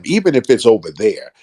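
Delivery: ordinary talk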